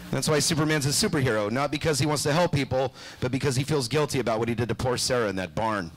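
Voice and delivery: normal talking voice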